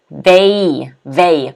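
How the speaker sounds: "they" pronounced VAY, drawn out; stressed syllable isolated. Shown as a demonstration. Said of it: "the stress is on the they".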